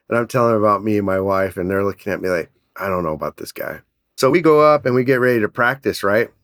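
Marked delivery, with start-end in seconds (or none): 2.8-3.8 low, soft retold doubt; wary aside. Competitors looking at the eager guy, wary aside. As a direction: mutter it low, imitating wary onlookers.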